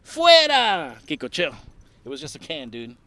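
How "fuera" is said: loud announcer shout. Retold or imitated. El Chacal catchphrase.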